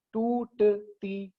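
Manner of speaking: टूटती broken into slow, separate syllables. Shown as a demonstration. Teacher shows students where the voice breaks, टूटती split syllable by syllable. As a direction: say टूटती very slowly, a gap after each syllable. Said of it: "syllables वो होते हैं जहां पर आवाज टूटती है".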